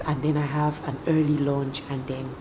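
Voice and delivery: ordinary talk, nothing marked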